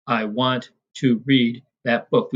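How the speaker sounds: slow beat landing on "want", "read", "book"; iambic stress. Shown as a demonstration. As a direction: pace it in pairs, leaning on "want", "read", "book". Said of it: "if we go back to that sentence".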